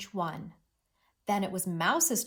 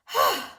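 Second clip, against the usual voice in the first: tired sigh; 4 semitones higher, 6 dB louder than usual. Shown as a demonstration. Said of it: "He's tired".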